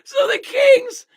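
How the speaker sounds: high, slow, drawn-out words through real laughter; laughing in disbelief. Real, not acted. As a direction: struggle to get the insult out while cracking up. Cracking up at the team's blunder.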